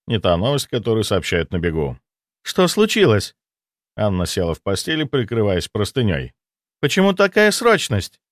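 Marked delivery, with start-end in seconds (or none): 2.4-3.4 alarmed woman. A narrator's reading. Anna voiced, alarmed. 6.8-8.2 alarmed woman. A narrator's reading. Anna voiced, alarmed.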